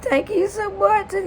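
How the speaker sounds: very high-pitched imitated tearful squeak; crying thanks. Crying woman thanking the plumber as she hugs him, squeaky mock sobbing. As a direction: squeal it out as if bawling. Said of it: "I'm crying and I'm crying".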